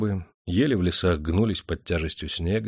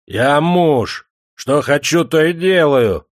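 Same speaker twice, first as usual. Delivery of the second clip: high, loud defiant shouting; drunken bravado; 7 semitones higher, 7 dB louder, slower than usual. Acted. Ermilka loudly insists on his rights as husband. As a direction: bluster and boast. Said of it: "снова осмелел Ермилка".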